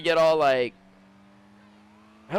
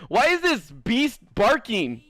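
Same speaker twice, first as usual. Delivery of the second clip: slow, higher mock disdain; slow mocking sneer; 6 semitones higher, 4 dB louder, much slower than usual. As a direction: drag it out with contempt. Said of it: "'cause it's like".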